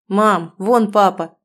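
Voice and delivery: put-on excited child calling out. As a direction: call out like an eager kid. Child calls out spotting dad.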